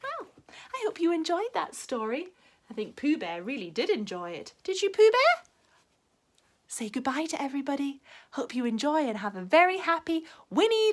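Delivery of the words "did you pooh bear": playful question to toy. Real, not acted. Talking to toy.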